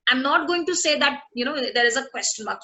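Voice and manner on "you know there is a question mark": usual speaking voice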